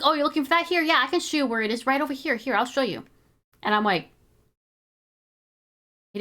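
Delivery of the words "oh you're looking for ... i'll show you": higher, bright eager-to-help voice; overly helpful salesman tone; eager cashier. Retold or imitated. Mimicking the cashier helping the man, eager and high. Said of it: "He's like".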